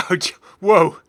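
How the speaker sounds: loud laughing gasp of surprise; startled whoa. Real, not acted. Seeing the scary pumpkin skin for the first time, startled. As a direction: jump back with a laughing whoa.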